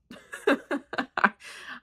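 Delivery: high, airy giggle; self-mocking high laughter; laughing. Real, not acted. Laughing at herself for not looking things up, airy giggles. Laughs at her own ignorance.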